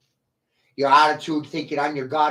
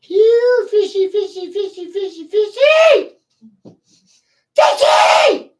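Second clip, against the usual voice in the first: very high, loud, slow sing-song fishy call; mocking fish call; 9 dB louder than usual. Real, not acted. Streamer taunts fleeing viewers as fish, in a squealing high call. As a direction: call them like a fish you're luring.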